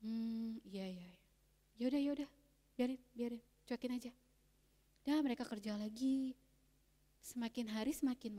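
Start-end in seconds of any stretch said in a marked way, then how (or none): 0.1-4.1 dismissive murmur. Acted. Villagers dismiss the cries.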